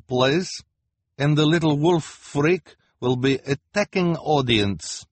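bossy fortune-teller giving orders; Madame Lulu voice. A narrator's reading.

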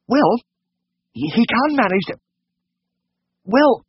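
very high, halting falsetto; flustered, confused lady. A narrator's reading. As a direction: falter and hesitate as an embarrassed lady. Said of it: "she was obviously confused".

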